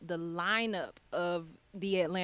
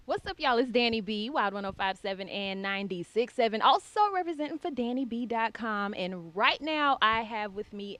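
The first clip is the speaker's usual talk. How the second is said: higher, louder, pumped announcer energy; hyped radio intro; 3 semitones higher, 5 dB louder than usual. Shown as a demonstration. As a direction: hit the intro like a DJ on air. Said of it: "So check us out".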